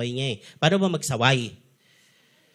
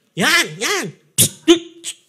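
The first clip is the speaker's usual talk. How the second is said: sharp, higher hissing shush; parent hushing child; 9 semitones higher, 6 dB louder, much slower than usual. Shown as a demonstration. Parent scolding a running child during a talk, sharp and high. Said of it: "Paano mo sasawayin yung bata?".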